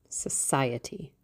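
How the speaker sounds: whispered with scorn, slow and breathy; muttered. A narrator's reading. The lad scorning his mother's talk of society, muttered. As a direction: spit the word out under your breath. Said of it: "muttered the lad".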